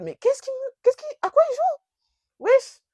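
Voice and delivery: very high, loud incredulous squeal; shocked disbelief. Retold or imitated. Her stunned reaction to his outburst, high loud disbelief. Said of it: "Un peu choquée, je suis en mode".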